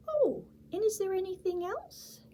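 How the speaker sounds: high squeaky little mouse; high, bright little mouse voice. Acted. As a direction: play the tiny excited mouse.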